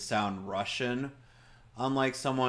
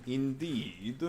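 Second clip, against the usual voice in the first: put-on clipped jokey Russian accent; a touch of a Russian accent; mock Russian accent; 2 semitones higher, 4 dB quieter than usual. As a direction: agree like a stern Russian. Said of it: "This is accent I do for joke".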